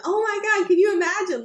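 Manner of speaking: mock giddy, recalling talk with husband; retold excitement. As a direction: replay the thrill of imagining it. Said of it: "crazy thoughts".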